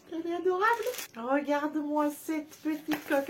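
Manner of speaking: high cooing delight over a cute case; squealy high-pitched adoration; cute gushing. Real, not acted. Gushing over new phone case.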